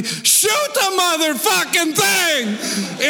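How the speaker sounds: slow, high panicked yell; imitating grandpa's frantic shout. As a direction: bellow the order at the grandson. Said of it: "my grandpa yells at me".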